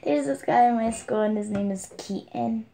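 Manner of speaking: ordinary delivery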